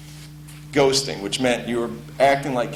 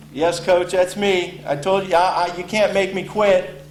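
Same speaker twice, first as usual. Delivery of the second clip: higher, eager reply; defiant freshman; 6 semitones higher than usual. Retold or imitated. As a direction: stand up to the coach. Freshman answers coach.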